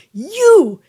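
loud, high accusing shout of You; mock furious woman pointing and yelling; hateful accusing yell.

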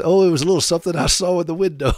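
a quick lightly voiced comic line; quoting Carol Burnett. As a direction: toss off the famous line brightly and fast. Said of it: "she says".

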